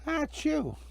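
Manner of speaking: high, soft acted sneeze; a small shivering sneeze. Karen sneezes in the woods.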